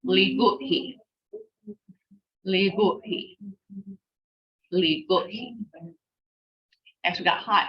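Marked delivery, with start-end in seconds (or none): 0.0-1.0 "Li, good. He." run together as one word; quick whole-word model of "Li, good. He."; fast, joined up. Shown as a demonstration. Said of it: "Three times fast". 2.4-5.6 "Li, good. He." run together, then repeated; no gaps inside "Li good He"; quick whole-word repetitions of "Li, good. He."; fast, joined up. Shown as a demonstration. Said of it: "Three times fast".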